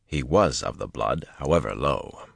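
ordinary delivery, nothing marked

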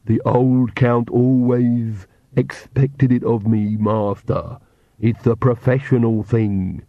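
very slow, lisping henchman; ludicrous lisped servant drawl; ridiculous servant voice. Acted. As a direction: drag it out like a creepy vampire's servant. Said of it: "And the ridiculous voice".

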